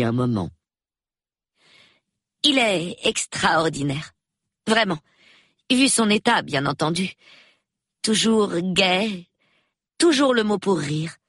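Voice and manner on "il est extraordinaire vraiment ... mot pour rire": high-pitched, somewhat forced praise; unnatural. A narrator's reading. Young woman describes the invalid, sounding unnatural. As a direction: praise him a little too carefully, pitched high. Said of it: "sa voix manquait de naturel".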